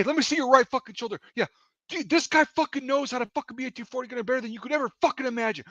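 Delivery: high-pitched imitated furious rant; screaming tirade. Gunner defending his teammate to range safety, screaming. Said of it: "he's just screaming".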